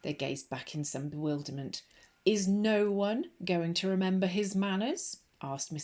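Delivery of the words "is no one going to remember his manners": loud, high, affronted question; affronted rebuke. A narrator's reading. Headmistress at the door scolding the children for their manners, loud. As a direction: shame the class into manners.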